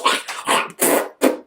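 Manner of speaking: imitated hawk and spit; mock spitting. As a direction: make exaggerated spitting noises. Imitates Jesus spitting on ground.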